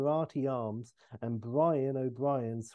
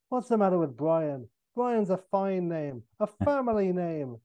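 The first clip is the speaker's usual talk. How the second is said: higher-pitched defensive Irish voice; Irish accent; 5 semitones higher than usual. Acted. O'Brien defending his name, defensive Irish accent. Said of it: "And Brian".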